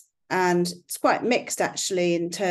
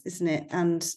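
normal talking voice

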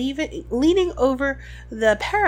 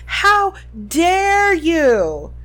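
very slow, higher furious yell; yelling while running away; 6 semitones higher, 7 dB louder, much slower than usual. A narrator's reading. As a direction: fling the outrage across the lawn. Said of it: "the figure shouted as she ran".

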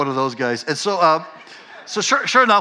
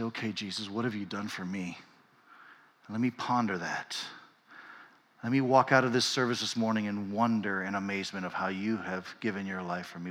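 soft, hushed prayer to Jesus; quiet prayer; 4 semitones lower, 8 dB quieter than usual. Shown as a demonstration. Pastor modeling a quiet prayer for the congregation, hushed and reverent. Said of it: "the challenge is to stop for a second and say".

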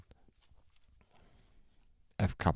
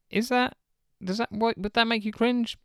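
mock question; 12 semitones higher than usual. Host mocks listener's question.

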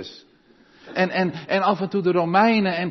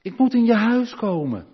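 Jesus calling up the tree; 6 semitones higher, 4 dB louder than usual. Acted. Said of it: "ga even naast Zacchaeus".

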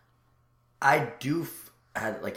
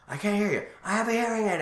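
high, loud mock scream; retold angry shout, pitched way up; imitating pissed-off screaming; 14 semitones higher, 8 dB louder than usual. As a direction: yell it like a furious customer. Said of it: "he's just screaming out, like, pissed".

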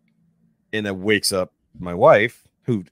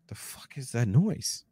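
slow, higher whispered question; whispered bewilderment; 5 semitones higher, 6 dB quieter, slower than usual. Retold or imitated. Dreamer woken by a weird noise, whispering in puzzlement. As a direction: whisper it slowly, puzzled and half-awake.

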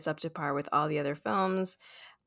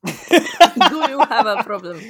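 louder, slow, laughing mock threat in a put-on villain voice; mock menacing villain; 5 semitones higher, 12 dB louder, slower than usual. Co-host mimics the French villain, hammed-up menace. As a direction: ham up the villain's challenge while laughing. Teasing about the French accent.

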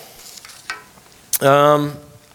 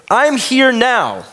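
slow emphatic; 11 semitones higher, 4 dB louder, much slower than usual. Acted. Slowed emphatic Jesus line.

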